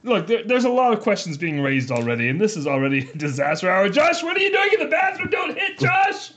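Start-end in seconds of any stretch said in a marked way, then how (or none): 3.9-6.3 calling out. Acted. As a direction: shout it high across the room. Calling out to guest.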